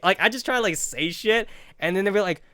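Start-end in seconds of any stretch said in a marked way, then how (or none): none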